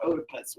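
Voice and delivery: "Oedipus" said OH-di-pus; odd pronunciation variant. Retold or imitated. Teacher quotes a professor's version, "Oedipus" as OH-di-pus. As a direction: start "Oedipus" with a long oh. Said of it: "I even heard somebody call it".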